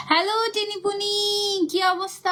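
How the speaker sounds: high, long-drawn hello; excited greeting. Real, not acted. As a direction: sing out a delighted hello to a friend. Greets a friend joining the live. (উচ্ছ্বসিত, টেনে টেনে উঁচু গলায় সম্ভাষণ)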